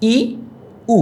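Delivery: ই then উ said as isolated, clearly shaped vowels; separate, careful vowel sounds ই and উ; vowel demo. Shown as a demonstration. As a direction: model ই and উ slowly for the class. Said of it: "উচ্চারণটা একটু দেখো".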